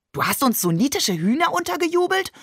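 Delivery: high-pitched, incredulous question; mock outrage. A narrator's reading. Shakir pretends to slap his brother over the hens, mock outrage. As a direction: protest it high, playfully scandalised. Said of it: "fragte Shakir und deutete eine Ohrfeige an".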